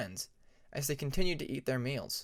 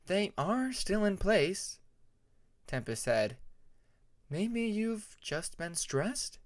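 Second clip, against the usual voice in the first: higher, slow, gentle dragoness asking; Tempest's voice; 6 semitones higher, slower than usual. A narrator's reading.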